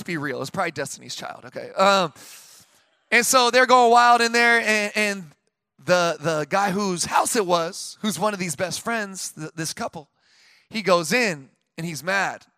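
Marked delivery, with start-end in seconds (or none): none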